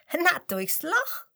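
high, loud, slow amused answer; laughing. A narrator's reading. Penguin laughing as it tells the lion how it came, amused. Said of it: "lacht der Pinguin".

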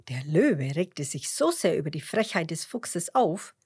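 usual speaking voice

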